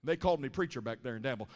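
normal talking voice

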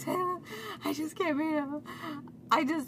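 mock almost-crying, high voice; imitating near-crying. Retelling a panicked text to Taylor, almost crying. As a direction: plead high, as if nearly in tears. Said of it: "I was almost crying".

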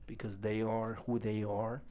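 ordinary talk

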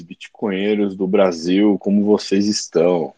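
ordinary talk, nothing marked

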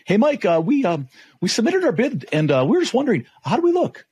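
high, anxious supplier asking about the bid; worried supplier calling the buyer, voice pitched high; stressed voice. Retold or imitated. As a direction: pitch it up like a nervous supplier on the phone. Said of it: "in a stressed voice".

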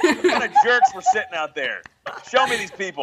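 high, laughing mock indignation. Real, not acted. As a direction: laugh while pretending to be offended. Joking outrage at the crowd.

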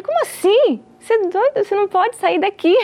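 voz aguda de chefe incrédulo; chefe indignado imitado. Retold or imitated. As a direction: imite o chefe protestando, com o tom lá em cima. Chefe indignado barra a saída dela. (chefe indignado, em tom agudo)

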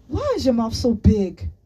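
high, loud accusing shout; angry shout. A narrator's reading. Boy accusing his friend of blabbing, shouting. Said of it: "Olu shouted".

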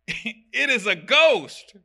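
hammed-up alarm at seeing a ghost; play-acted ghost scare; mock fright. Disciples frightened, quoted comically.

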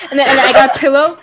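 ordinary delivery

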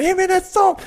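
very high, loud mock alarm; hammed-up squealed complaint; alarmed protest. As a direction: imitate a stoner yelping in shock. Acted stoner's alarm at soap.

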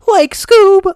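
very high, loud cartoon squawk; Shaggy. Showing how the cartoon voice should go, very high and loud. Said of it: "he's gotta make it more".

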